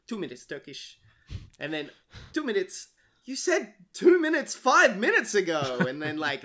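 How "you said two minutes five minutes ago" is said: raised, louder quoted complaint; quoting an annoyed character with a lift; quoted film line. Retold or imitated. Friend quoting the Snatch film line with raised voice.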